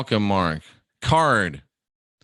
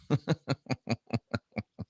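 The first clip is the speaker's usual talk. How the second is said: laughing; 10 dB quieter than usual. Real, not acted. Laughs at chat.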